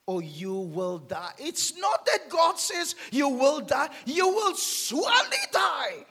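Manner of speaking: loud high preaching shout; thundering warning. Acted. Preacher declaring God's true words to the church, loud shout.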